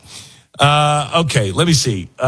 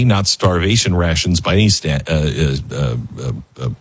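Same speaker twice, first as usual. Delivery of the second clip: losing the thread; 7 semitones lower than usual. Real, not acted. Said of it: "God, I can't even think today".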